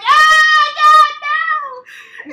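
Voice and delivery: high screaming disgust; horrified shout. Retold or imitated. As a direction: shriek in horror, half laughing. Horrified younger self.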